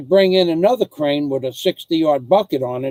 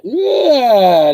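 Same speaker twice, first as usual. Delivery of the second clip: loud, high fake bawl; mock crying wail; 9 semitones higher, 6 dB louder than usual. Joking about not crying over the smack, mock wail. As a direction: let out a hammed-up crybaby wail, sliding down.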